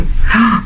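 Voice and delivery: short dramatic gasp; storyteller's audible intake of breath. Acted. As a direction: gasp in mock alarm. Narrator gasps at hungry troll.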